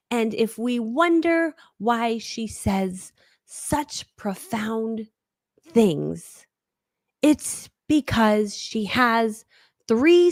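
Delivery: extremely slow, weighty delivery with long pauses; Oprah. Acted. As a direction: leave a long gap between each word, imitating a profound speaker. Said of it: "the pace at which Oprah talks".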